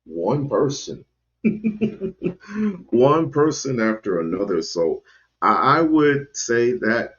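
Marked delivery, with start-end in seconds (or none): 1.4-2.9 short incredulous laugh; disbelieving laugh. Real, not acted.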